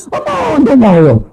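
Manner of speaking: high, drawn-out mock wonder; exaggerated amazement. Woman reacting to every word, exaggerated amazement. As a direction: gush slowly as if astonished. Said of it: "말 한마디 한마디마다".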